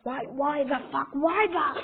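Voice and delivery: baffled question building to a high, loud outburst; mounting incredulous freak-out, pitch climbing; worked up. Acted. Villain leader reacting to a flaming T-Rex, increasingly worked up. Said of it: "So you're getting a bit worked up".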